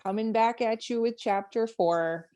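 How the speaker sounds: ramp up the Minnesota O's for an audiobook intro; Minnesota accent. Shown as a demonstration. Mock audiobook intro in a hammed-up Minnesota accent. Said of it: "This is how I will read any audiobooks".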